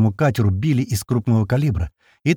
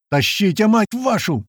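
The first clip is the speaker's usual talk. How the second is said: high, urgent cursing order; narrated shout, pitched well up; yelling; 10 semitones higher, slower than usual. A narrator's reading. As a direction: swear at them to haul. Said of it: "орёт откуда-то позади Слива".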